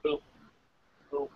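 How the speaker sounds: ordinary delivery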